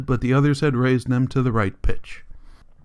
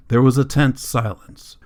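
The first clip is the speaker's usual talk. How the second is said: usual speaking voice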